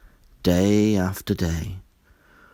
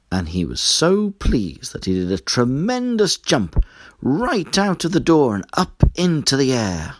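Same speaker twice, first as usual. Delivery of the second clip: ordinary delivery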